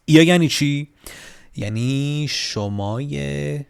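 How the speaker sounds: normal talking voice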